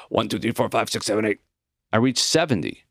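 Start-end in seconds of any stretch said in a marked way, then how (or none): 0.1-1.4 rapid, even run of numbers after a big breath; quick exhaled count. A narrator's reading. Student takes his old professor's breath test, counting quickly and steadily. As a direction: count briskly on one outgoing breath. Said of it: "I quickly exhaled the numbers".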